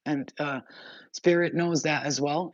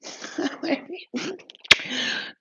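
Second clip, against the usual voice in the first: breathy laugh; 13 semitones higher than usual. Real, not acted. Laugh after story.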